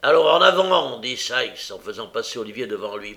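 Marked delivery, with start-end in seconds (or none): 0.0-1.0 loud, raised marching order; forceful, impatient command; barked order. A narrator's reading. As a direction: push them on with a firm command. Burglar ordering boy forward.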